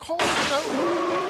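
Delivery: a high, very slow half-shouted "hold on, no"; retold shocked shout of disbelief; stunned protest. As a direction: stretch it out, floored by the surprise. Caught off guard by her.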